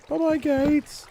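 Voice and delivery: high, teasing farewell; sing-song bye-bye. Real, not acted. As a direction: wave them off playfully. Waving off broken gates.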